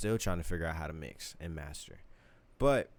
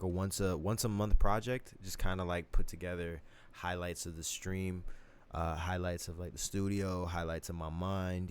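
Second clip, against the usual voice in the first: usual speaking voice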